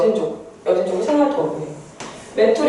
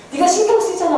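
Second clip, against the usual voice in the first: higher-pitched mock drama outburst; drama confession; 7 semitones higher than usual. Imitating a drama lead confessing to their love, mock exasperation. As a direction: blurt it out like a TV drama lover. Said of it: "드라마나 영화 같은 데 보면은".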